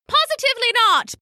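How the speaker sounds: high, quick refusal; fast, high-pitched indignation; Pippo. A narrator's reading. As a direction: shut it down sharply as a fussy penguin. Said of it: "said Pippo".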